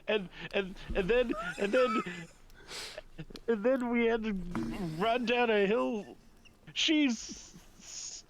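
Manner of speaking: high-pitched, blubbering rush of words; happy sobbing. Acted. Aurora recounting the Feywild to Sophie, blubbering. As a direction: stammer through hammed-up weeping. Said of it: "happy sobs at this point".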